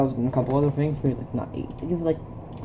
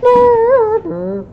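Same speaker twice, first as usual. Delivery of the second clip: much higher, loud drawn-out cry; dramatic shout; 9 dB louder than usual. Acted. Character crying out murder, loud dramatic cry. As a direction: belt it out.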